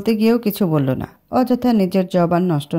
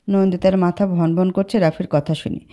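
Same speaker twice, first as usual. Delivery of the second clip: ordinary delivery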